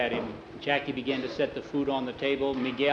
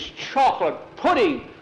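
much higher, louder, disbelieving shout; outraged scoff; 11 semitones higher, 5 dB louder than usual. Retold or imitated. Miguel scoffing at the boy's pudding, outraged shout. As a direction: scoff at it like a grown man insulted.

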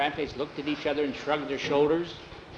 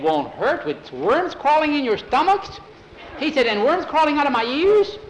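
rising panic; panicky disbelief; 11 semitones higher, 7 dB louder than usual. Acted. Skeeter protests to Bowie about worms, high and alarmed.

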